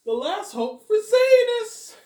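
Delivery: hammed-up Robert, slow and high; Robert impression. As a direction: stretch it like a dramatic trailer voice. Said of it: "Not a bad impression of Robert".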